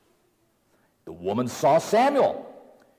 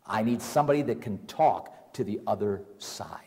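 breathy secret plea to find a medium; hushed whisper; 7 semitones lower, 6 dB quieter than usual. Acted. King secretly asking for a medium, whispered.

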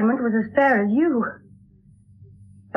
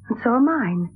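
ordinary talk, nothing marked

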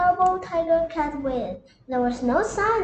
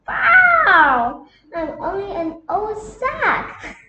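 shouted wow, then a slow odd sack voice; cat playing a sack; 5 semitones higher, 7 dB louder, much slower than usual. Cat hiding in the sack, slow fake voice.

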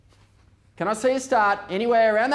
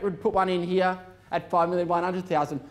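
usual speaking voice

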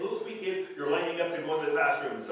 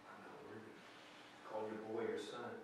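hushed echo; 6 semitones lower, 14 dB quieter, faster than usual. Real, not acted. Echoed confession, hushed.

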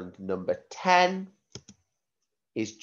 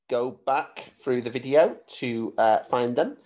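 ordinary talk, nothing marked

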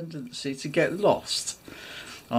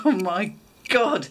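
tag; 5 semitones higher than usual. Retold or imitated. Husband's shocked reaction, retold.

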